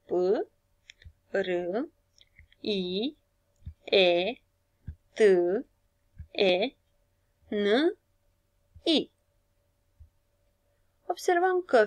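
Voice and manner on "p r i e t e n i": P, R, I, E, T, E, N, I said one by one; sounding out letters. Shown as a demonstration. Teacher sounds out prieteni letter by letter for pupils. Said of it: "Dar oare sunete?".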